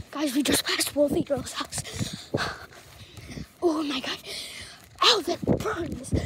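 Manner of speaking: real panting between phrases; out of breath. Real, not acted. Runner telling viewers they can't do this, winded. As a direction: gasp the words out while running. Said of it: "even though I'm out of breath".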